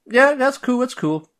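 high, light brush-off; chirpy pretend it's fine; breezy fake okay. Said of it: "She's just like".

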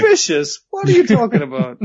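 play-offended, rising in pitch on the question; mock indignation. Co-host answers for the suspicious mask seller, mock indignant. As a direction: protest the word in pretend innocence.

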